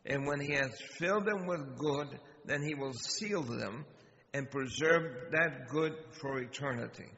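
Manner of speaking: ordinary talk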